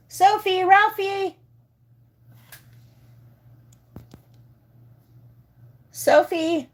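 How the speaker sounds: high, loud squeal calling the dog; squealy calling voice. Real, not acted. Owner calling for her dog to come say hi, squealy and loud. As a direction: call out squeaky and high, carrying across the house. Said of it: "squealy, squeaky, annoying voice".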